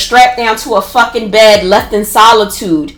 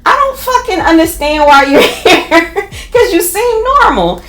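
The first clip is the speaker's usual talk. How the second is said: higher, half-laughing roommate voice; roommate's voice; 9 semitones higher than usual. Retold or imitated. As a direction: do the blunt roommate, laughing through it. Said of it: "She's like".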